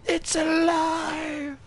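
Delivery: high, drawn-out maniacal cry; mad scientist shout. Pretend. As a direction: shout it like Dr. Frankenstein. Frankenstein quote after "Is he alive?.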